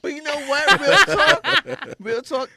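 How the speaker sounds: high, loud excited shout; loud and laughing. Real, not acted. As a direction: call it out over the laughter.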